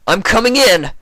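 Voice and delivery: very loud, high shout through door; calling out. Acted. Shining Armor outside the door, very loud urgent shout. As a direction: bang and call urgently. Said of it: "yelled Shining Armor".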